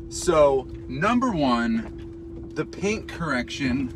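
drawn-out admiration; slow and awed. Real, not acted. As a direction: stretch it out in awe. Admiring paint slowly.

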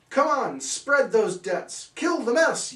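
high, loud, rallying yell; high loud cat's rallying cry. Acted. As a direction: shout orders to the crowd. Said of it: "yelled the cat".

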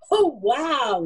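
wide-eyed wonder; amazed. Acted. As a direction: let out an awed little wow. Mouse amazed at piles of straw.

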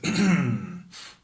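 loud throat clearing; coughing fit. Real, not acted. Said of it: "Öksürüyorum sürekli".

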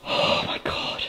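breathless whispered oh my God; real frightened whisper; scared whisper. Real, not acted. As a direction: barely dare to speak. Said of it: "just freaking out".

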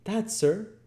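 put-on very high, agitated boy; crying out as Oliver. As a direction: exclaim as an alarmed child. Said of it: "cried Oliver".

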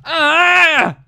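loud high shout of frustration; frustrated yell. Real, not acted. Gamer yelling after losing the Ditto, frustrated shout. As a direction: let out an angry yell.

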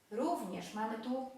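normal talking voice

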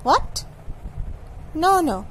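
ordinary talk

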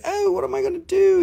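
very high mock fretting; exaggerated high-pitched mock dismay; imitated fretting. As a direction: put on a high, helpless fluster. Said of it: "you're gonna be like".

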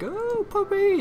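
put-on very high, squealing kid voice; kids cooing at a puppy. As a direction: coo at the dog like an excited child. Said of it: "and they're like".

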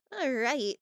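ordinary delivery

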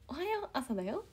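high, sing-song cartoon alarm greeting; bright high mascot morning call; Korasho alarm voice. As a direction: chirp it like a kids' mascot alarm clock. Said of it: "そんな感じのイントネーションのやつ".

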